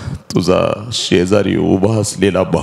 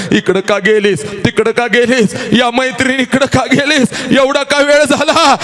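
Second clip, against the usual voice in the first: 1 strict father nagging. Retold or imitated. Daughter mimics strict father.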